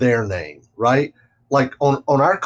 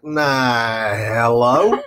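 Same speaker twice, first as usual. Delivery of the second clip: imitating a slow Southern drawl; mock slow phone greeting; slow drawled hello; much slower than usual. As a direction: drag the hello out lazily. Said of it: "I doubt that she actually sounded like that".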